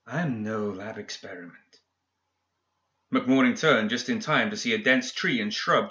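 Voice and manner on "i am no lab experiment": rustling spirit voice. A narrator's reading. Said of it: "brushing of sticks and branches".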